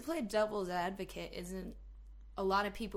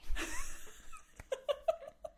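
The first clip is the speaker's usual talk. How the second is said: high, breathy wheeze of laughter; real, long, airless giggle; wheezing laugh. Real, not acted. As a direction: laugh helplessly with hardly any voice. Laughing at own aside.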